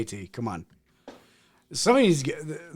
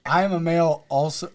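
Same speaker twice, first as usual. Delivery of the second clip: ordinary delivery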